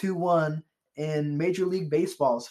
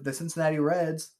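ordinary talk, nothing marked

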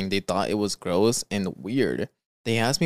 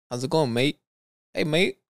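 put-on Australian accent; 5 semitones higher than usual. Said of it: "we're not great at accents".

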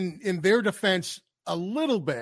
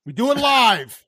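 louder insistent protest; mock outrage; 2 semitones higher, 6 dB louder than usual. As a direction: belt it out in mock indignation. Mock-outraged reply to pre-record accusation.

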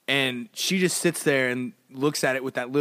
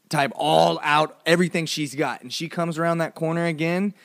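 usual speaking voice

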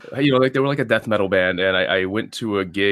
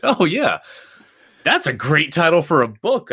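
high, louder eureka; light up at the idea; recalling the moment the title struck; excited realization; 9 semitones higher, 4 dB louder than usual. Retold or imitated. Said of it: "And I was like".

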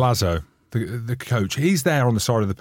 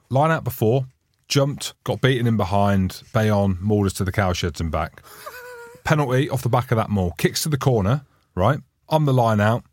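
ordinary talk, nothing marked

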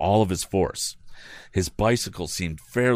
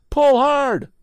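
shouting; 7 dB louder than usual. A narrator's reading. Tom shouting to the woman.

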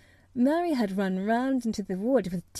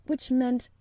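ordinary delivery

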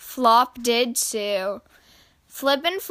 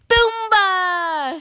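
high, long drawn-out call; high-pitched game cry; gleeful shout; 8 semitones higher, 4 dB louder than usual. A narrator's reading. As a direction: shout it out like kids at play. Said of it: "shouted Flip and Hop as loudly as they could".

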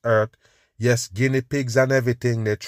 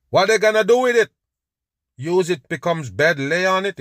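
much higher and louder, fast, scoffing disbelief; incredulous mocking rant; 7 semitones higher, 4 dB louder than usual. Real, not acted. Ridiculing the cotton claim.

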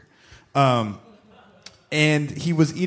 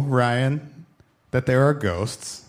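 ordinary talk

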